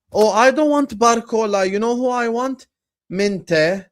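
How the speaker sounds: mocking imitation of a picky supporter; mock fan voice. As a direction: parody a fan who rejects Barcola. Mocking a fan's wishlist.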